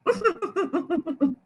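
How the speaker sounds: soft, mischievous giggle; mouse's gleeful laugh. Acted. Mouse laughing at the suffering elephant, mischievous giggle. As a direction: giggle like a cheeky little mouse. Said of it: "Mouse is sitting inside going".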